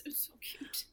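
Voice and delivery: soft whispered coo; gushing whisper. Real, not acted. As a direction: whisper it, overcome by cuteness. Melting over the toddler's cheeks.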